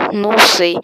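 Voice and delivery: loud, sudden yell; shout on request. Shown as a demonstration. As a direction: yell it out for the chat. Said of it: "Grita, não sei".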